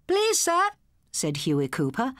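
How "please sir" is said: high, loud eager pupil; eager schoolboy. A narrator's reading. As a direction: pipe up to teacher. Schoolboy voiced in story.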